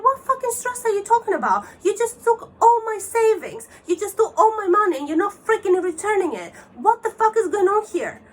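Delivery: high, loud, indignant rant; angry outburst. Retold or imitated. Victim lashing out at scammer over lost savings, furious retelling. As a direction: fire accusations at him, pitch way up.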